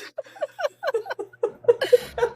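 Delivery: high squealing laughter; high laughing fit. Real, not acted. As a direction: burst into a long squealing laugh. Laughing at picture.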